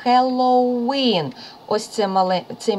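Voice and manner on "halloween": slow Halloween, high and held on WEEN; stress on last syllable. Shown as a demonstration. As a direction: say "Halloween" leaning on the final "ween". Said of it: "наголос маємо на останньому складі".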